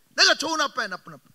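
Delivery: loud, pressing question to the crowd; demanding question. Acted. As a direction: push the congregation for an answer. 청중에게 다그치듯 묻기. (청중에게 큰 소리로 다그치듯 묻기)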